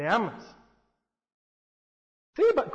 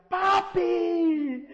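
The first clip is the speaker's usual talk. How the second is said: put-on much higher, delighted toddler's "Papi"; little daughter calling; 10 semitones higher, 3 dB louder than usual. Pastor voicing his toddler greeting him at daycare, high child's call. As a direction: call out like a happy little girl.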